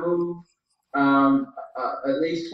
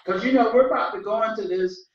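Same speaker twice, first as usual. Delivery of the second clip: ordinary talk, nothing marked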